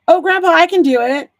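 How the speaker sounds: put-on high, loud, quick kid's offer; imitating her son jumping in, fast and high; eager grandson. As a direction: pipe up loudly as an eager grandchild. Voicing son jumping in to help.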